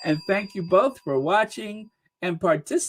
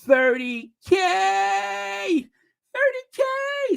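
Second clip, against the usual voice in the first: high, drawn-out triumphant cry; celebrating; 12 semitones higher, slower than usual. Real, not acted. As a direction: sing out the milestone in delight. Celebrates reaching thirty thousand subscribers.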